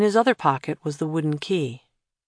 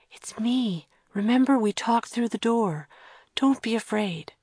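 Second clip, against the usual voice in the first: reassuring whisper; 7 semitones higher than usual. Acted. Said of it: "Kira whispered".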